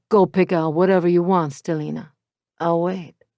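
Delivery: smooth and amused, calming her; Matteo. Acted. Matteo reassures his girlfriend, smooth Italian-accented voice.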